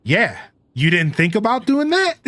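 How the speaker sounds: climbing high into an incredulous question; mock disbelief. Streamer answering the stunned chat guy, mock disbelief. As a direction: tease with pitch soaring toward the end. Retells own mock-puzzled reply to viewer.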